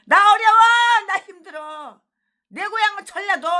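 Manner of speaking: loud, high whining cry of a beggar pastor; mock whining plea. Preacher mimicking a pastor begging for money, loud mock whine. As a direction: shout out the fake hardship plea. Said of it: "눈이 떠가지고".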